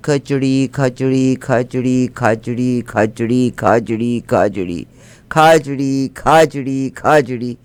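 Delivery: खिचड़ी drifting into खचड़ी, then खाचड़ी; sing-song chant of a mangled word; word getting garbled. Acted. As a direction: let the word blur as it repeats. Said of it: "आप एक ही लफ्ज़ बोलते जाएं".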